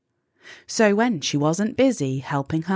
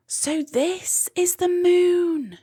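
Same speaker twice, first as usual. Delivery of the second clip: much higher, slow, amazed; gasped in awe; 12 semitones higher than usual. A narrator's reading. Molly seeing the bright street lamp, amazed and awed. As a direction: breathe it out in wonder. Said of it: "gasped Molly".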